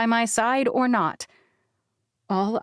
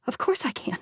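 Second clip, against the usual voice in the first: breathy whispered resolve; 4 semitones higher, 4 dB quieter than usual. A narrator's reading. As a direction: say it under your breath.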